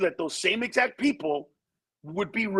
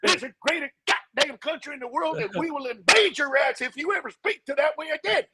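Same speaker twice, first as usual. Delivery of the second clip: high-pitched, indignant patriotic rant; hammed-up outrage, firing back fast at first; mock angry American; 8 semitones higher than usual. Offended American telling off a Frenchman, mock outrage. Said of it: "People would be mad".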